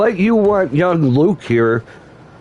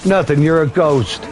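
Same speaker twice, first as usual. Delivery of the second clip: ordinary delivery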